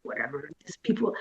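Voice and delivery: low, quick mutter to yourself; very soft, hurried inner grumble; dismissive aside. Retold or imitated. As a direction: drop it under your breath, dismissively. Dismissive private thought after polite reply.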